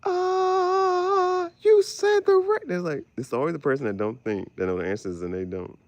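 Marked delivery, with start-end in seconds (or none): 0.0-2.6 high, slow astonished praise; mock amazed family member. The slow one gives the best answer, high amazed cry. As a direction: gush in disbelief. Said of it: "And it's like".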